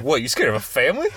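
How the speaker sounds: quick, mildly incredulous movie quote; Winston Duke. Retold or imitated. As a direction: imitate a baffled dad, rising question. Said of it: "He's like".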